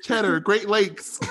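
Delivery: high, drawn-out mock Wisconsin accent. Friend piling on regional buzzwords through laughter, Wisconsin accent. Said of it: "Do we got a Wisconsin?".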